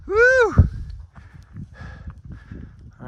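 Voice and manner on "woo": high loud whoop; excited whoop. Real, not acted. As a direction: cheer out with a high woo. Summit push, cheering out.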